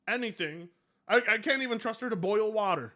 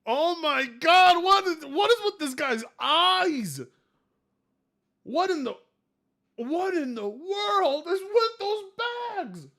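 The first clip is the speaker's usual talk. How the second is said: high, loud shocked cry; 9 semitones higher, 6 dB louder than usual. Real, not acted. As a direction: recoil in shock. Shocked reaction.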